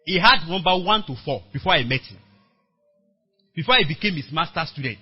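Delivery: usual speaking voice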